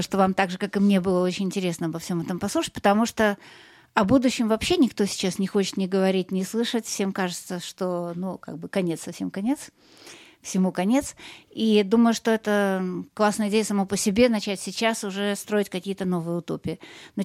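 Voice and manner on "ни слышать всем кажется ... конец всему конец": ordinary talk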